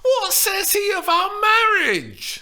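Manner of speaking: high, loud shriek of impatience; shrieking Juliet. A narrator's reading. Young Juliet shrieks at her nurse, impatient. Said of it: "Steph shrieked".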